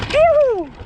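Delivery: relieved sigh. Real, not acted. Exhale after sketchy descent.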